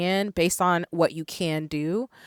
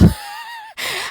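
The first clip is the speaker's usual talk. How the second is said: shrieking laugh. Real, not acted. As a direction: let out a high shriek of a laugh. Overwhelmed by the donation total.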